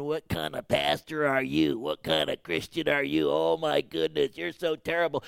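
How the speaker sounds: the enemy's accusing voice. Retold or imitated. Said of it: "when the enemy is in your mind going".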